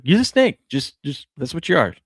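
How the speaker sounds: put-on high, taunting kid voice; bratty kid brothers. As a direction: tease her like a little brother would.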